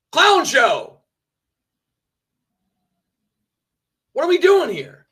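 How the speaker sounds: loud, high exasperated outburst; exasperated yelling. Real, not acted. Streamer demands promotion from his crew, exasperated yelling. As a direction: yell it in disbelief.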